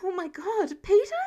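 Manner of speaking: high, shocked gasp of a name; startled cry, rising sharply. A narrator's reading. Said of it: "he heard Karen gasp".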